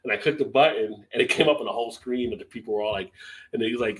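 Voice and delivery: ordinary talk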